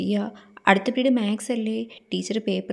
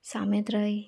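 whisper; 6 dB quieter, slower than usual. Acted. Whisper in class.

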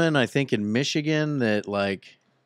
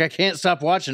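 exasperated; 7 semitones higher, 4 dB louder than usual. Retold or imitated. Retold self, pleading.

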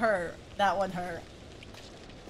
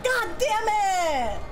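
frustrated outcry; 6 semitones higher than usual. Real, not acted. Player protesting an unwanted game outcome, high frustrated wail.

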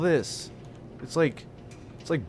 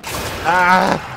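loud high yelp of fright; sharp frightened ah; startled scream; 7 semitones higher, 8 dB louder than usual. Real, not acted. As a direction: yelp in sudden fear. Scream at camera glitch.

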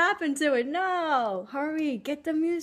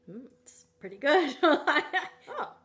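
usual speaking voice

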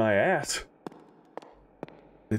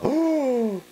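startled gasp; 5 dB louder than usual. Real, not acted. Frightened by the scene.

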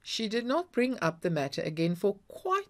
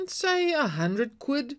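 manfully; 9 semitones higher, 4 dB louder, slower than usual. A narrator's reading. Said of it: "said Paddy manfully".

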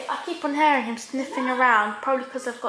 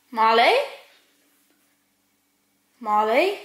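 calling; 6 dB louder than usual. Real, not acted. Calling for lost dog.

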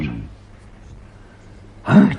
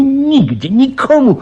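nigdzie, nikomu pushed much higher and louder; emphatic; 4 dB louder than usual. Acted. Commissioner boasting to the professor, emphatic. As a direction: proudly stress nigdzie and nikomu. Proud claim, no one fooled him.

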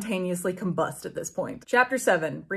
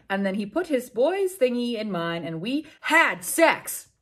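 normal talking voice

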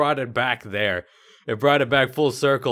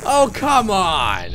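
frustrated groan; 8 semitones higher, 6 dB louder, much slower than usual. Real, not acted. Just died in game.

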